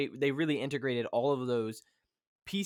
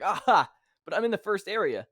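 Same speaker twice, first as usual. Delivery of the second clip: exasperated protest; 6 semitones higher, 6 dB louder than usual. Retold or imitated. Player protesting blocked game area.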